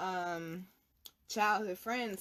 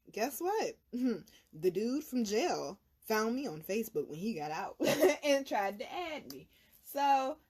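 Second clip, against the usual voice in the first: ordinary talk, nothing marked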